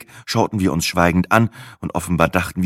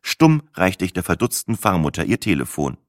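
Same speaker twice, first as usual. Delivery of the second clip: ordinary delivery, nothing marked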